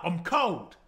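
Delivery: normal talking voice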